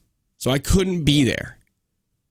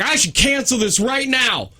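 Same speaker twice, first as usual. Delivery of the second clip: high-pitched mock angry threat; imitated pissed-off man; 10 semitones higher than usual. Tow driver threatening to cancel the job, mock angry. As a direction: retell his irate threat, pitched well up. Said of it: "The dude is pissed".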